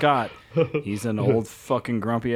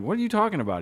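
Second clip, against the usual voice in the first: retold incredulous protest; 10 semitones higher, much faster than usual. Young trainer disputing his mentor's warning, mock incredulous. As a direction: act out a baffled comeback, pitch jumping up.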